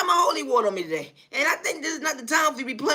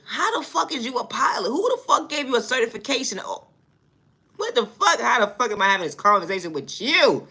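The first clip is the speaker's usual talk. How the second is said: ordinary delivery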